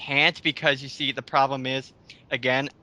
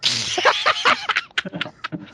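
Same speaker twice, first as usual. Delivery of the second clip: high burst of laughter; 3 dB louder than usual. Real, not acted. Laughing at the [name] joke.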